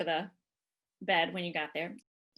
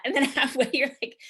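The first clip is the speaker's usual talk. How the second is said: ordinary talk, nothing marked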